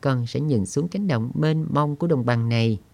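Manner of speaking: put-on high child voice, wistful and dreaming aloud; dreamy little girl. Girl speaks to her uncle.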